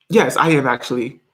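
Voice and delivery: ordinary talk, nothing marked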